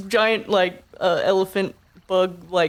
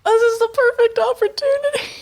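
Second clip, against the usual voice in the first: excited pitch. Acted. Arvin pitching the moment to onlookers, high eager voice. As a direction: sell it. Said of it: "pitching to crowd".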